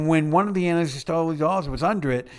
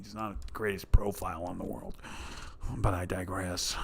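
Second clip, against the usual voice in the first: whispered aside; 7 semitones lower, 10 dB quieter than usual. Real, not acted. Aside while off mic.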